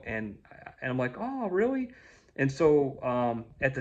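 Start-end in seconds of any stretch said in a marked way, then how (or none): 1.2-1.9 mock surprise, bright and high; retold happy surprise; surprised. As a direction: pitch jumps way up on really. His own surprised reply, retold.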